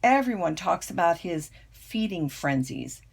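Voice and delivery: plain reading voice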